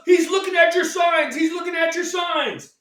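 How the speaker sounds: high, loud yelled warning; pitcher shouting a warning. Retold or imitated. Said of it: "He goes".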